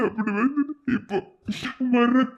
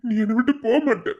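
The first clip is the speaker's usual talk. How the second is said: usual speaking voice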